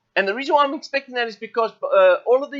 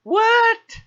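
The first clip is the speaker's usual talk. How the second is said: high, loud startled yelp; surprised what; 13 semitones higher, 5 dB louder than usual. Real, not acted. Trader seeing yet another target hit, surprised yelp. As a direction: jump up in real surprise.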